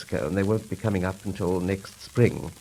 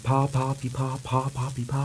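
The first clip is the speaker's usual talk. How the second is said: chirpy vocal Morse signal; mimicked Morse beeps; 4 semitones higher than usual. Storyteller imitating the monster's Morse code beeps. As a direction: tap out pa and pee like Morse code.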